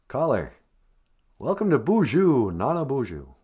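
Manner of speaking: ordinary delivery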